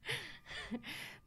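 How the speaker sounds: breathy wheezing laugh; wheezy laugh. Real, not acted. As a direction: let out a high wheezy laugh. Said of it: "My wheezy laugh".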